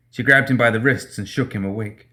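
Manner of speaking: usual speaking voice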